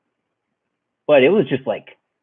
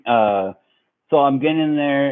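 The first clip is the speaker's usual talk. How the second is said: ordinary talk, nothing marked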